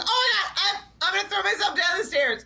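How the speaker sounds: high, laughing shriek; a giddy laughing yell; mimicking Kim's laughing squeal. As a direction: squeal it through laughter like Kim. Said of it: "Whenever Kim says she's laughing, she goes".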